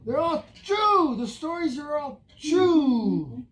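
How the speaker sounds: emphatic insistence. Acted. Storyteller defends his stories against crazy lies, insisting loudly. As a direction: push hard on "true". Insists after being called a liar.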